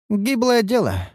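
high, drawn-out scornful verdict; dismissive snort. A narrator's reading. Elf woman writing off a feeble trainee, snorting dismissively. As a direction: snort it in a high female-character voice. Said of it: "фыркнула Ниору".

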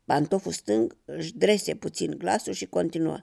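ordinary delivery

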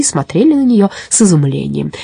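ordinary delivery, nothing marked

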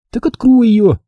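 put-on high, urgent girl's command; insistent. A narrator's reading. Mia presses Jack to open the door, insistent. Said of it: "настаивала Мия".

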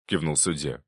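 usual speaking voice

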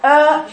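long dark "آ", the mistaken heavy way; heavy hamza. Shown as a demonstration. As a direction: open upward and make "آ" heavy. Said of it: "يبقى فخمت".

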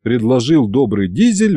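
plain reading voice

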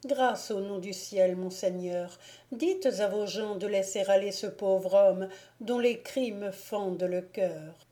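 ordinary delivery, nothing marked

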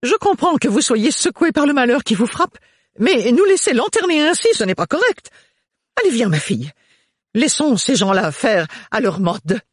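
loud, high fussy mother; bossy matron pushing her daughter; overbearing mother. Acted. Mother voiced again.

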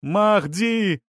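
high fervent cry; shouting. A narrator's reading. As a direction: hail a saviour from the crowd. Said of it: "кричал третий".

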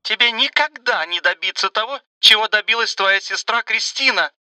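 put-on high, menacing old woman's voice; threatening mother. A narrator's reading. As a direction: taunt like a gloating mother. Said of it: "угрожающе заявила она".

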